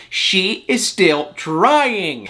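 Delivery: "trying" stretched very slow and falling; drawn out. Real, not acted. Vlogger mocking Meri's persistence, slow emphatic drawl. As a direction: lean slowly into "trying". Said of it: "when I say trying".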